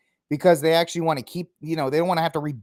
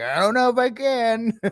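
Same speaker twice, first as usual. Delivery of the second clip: mock Trump; 7 semitones higher than usual. Host retelling Trump's reply to Putin, pitched-up and unsure. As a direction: pitch it up, unsure and a bit sheepish. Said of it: "And Trump's like".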